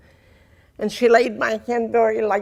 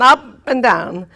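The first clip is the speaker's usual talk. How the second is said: voice swooping up and down; 2 semitones higher, 6 dB louder, slower than usual. Shown as a demonstration. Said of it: "get my voice to go up and down".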